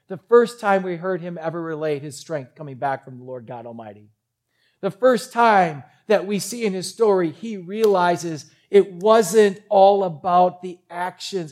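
ordinary delivery

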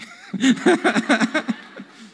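high, loud burst of laughter; real laughter. Real, not acted. Husband laughing about crying over his wife. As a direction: laugh it off, caught out by your own tears.

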